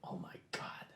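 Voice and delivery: very soft, breathy whisper; exasperated whisper. Acted. Reacting to a companion's experiment, exasperated whisper. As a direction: whisper it in disbelief. Whispered exchange while hiding.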